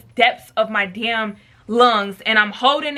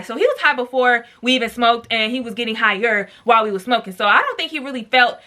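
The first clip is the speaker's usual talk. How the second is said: ordinary talk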